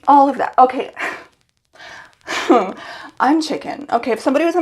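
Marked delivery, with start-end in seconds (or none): 0.1-0.5 a startled, louder second look; louder emphatic repeat. Real, not acted. Staring at her cut hair, exclaiming louder. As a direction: say it again, louder and higher.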